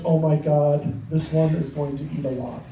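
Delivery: low, heavy mock-mother voice; imitated mother. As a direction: deliver it low, trailing down into resignation. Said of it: "she looks at me and she says".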